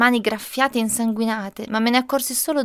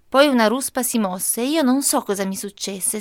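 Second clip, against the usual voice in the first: ordinary delivery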